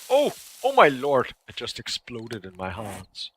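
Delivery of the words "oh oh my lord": loud, high startled cry; surprised exclamation. Real, not acted. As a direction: react to it blowing up. Item explodes in hands.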